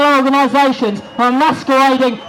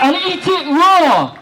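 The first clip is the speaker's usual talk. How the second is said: slow and high, each word leaned on; slow emphatic command; 5 semitones higher, slower than usual. Real, not acted. Rally speaker signing off to the crowd, slow and emphatic. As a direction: lay it down like a rule, word by word.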